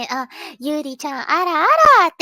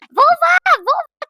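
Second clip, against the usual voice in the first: excited call; 13 semitones higher, 7 dB louder than usual. Real, not acted. Calling out excitedly.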